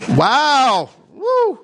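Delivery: high, loud astonished wow; stunned high whoop; wow reaction. Real, not acted. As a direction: react with real shock at the verse. Shocked by Saul's harsh words.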